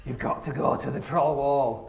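slow, eerie inner voice; a nagging voice in his head, drawn out slowly; slow, haunting voice of conscience; little inner voice. Retold or imitated. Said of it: "this little voice was like".

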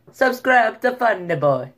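high, loud imitation of an Italian accent; mock Italian accent. Shown as a demonstration. Vlogger running through accents for his plug, mock Italian. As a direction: belt it in a put-on Italian accent.

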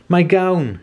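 excited yell. A narrator's reading. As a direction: shout it with delight. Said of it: "he yelled".

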